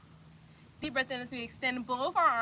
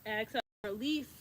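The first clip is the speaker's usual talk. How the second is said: usual speaking voice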